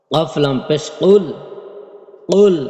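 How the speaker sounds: কুল with the ق from the root of the tongue, held back and deep; deep qaf sound. Shown as a demonstration. Teacher showing students the deep qaf in কুল. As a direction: stress the throaty ক in কুল. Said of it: "এই কফ উচ্চারণ হবে, কফ উচ্চারণটা খেয়াল করি".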